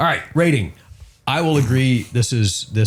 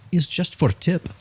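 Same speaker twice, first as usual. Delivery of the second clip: Russian waitress; 8 semitones higher, slower than usual. Retold or imitated. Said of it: "Little, little Russian lady".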